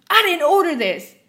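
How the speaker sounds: furious customer shrieking; screaming. Retold or imitated. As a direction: scream it waving the cup. Rabid customer yelling.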